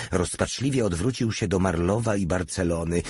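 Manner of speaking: plain reading voice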